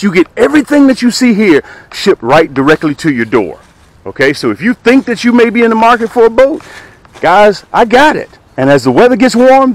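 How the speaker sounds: loud, high, pushy sales pitch; hard-sell pitch. Acted. Fishing vlogger hawking his used boat to viewers, loud hard sell. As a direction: sell it like a late-night ad host.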